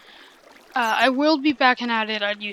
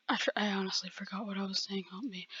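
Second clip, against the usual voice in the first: whisper; 3 semitones lower, 12 dB quieter, faster than usual. Real, not acted. Forgot his point mid-video.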